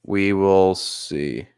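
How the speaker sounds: low-pitched, measured football commentary; live play-by-play. Real, not acted. Streamer calling football for viewers, low play-by-play. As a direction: call the game evenly, low in the voice.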